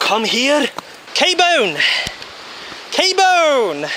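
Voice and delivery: calling out. Real, not acted. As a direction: shout for him to come. Calling friend across forest.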